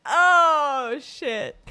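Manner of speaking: usual speaking voice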